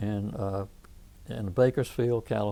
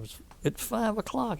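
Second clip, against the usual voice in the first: high, softer retold disbelief; incredulous; 11 semitones higher, 5 dB quieter than usual. Friend hears caller is in Bakersfield at dawn, incredulous. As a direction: recreate your surprise, pitch jumping up. Retold surprise at early phone call.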